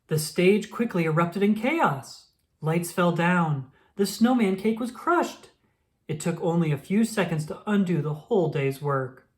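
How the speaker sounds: ordinary delivery, nothing marked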